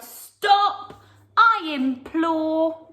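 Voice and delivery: high, loud, slow plea; pleading cry. Acted. Old lady crying to the animals to stop, high and loud. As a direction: beg them to stop. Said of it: "The little old lady cried".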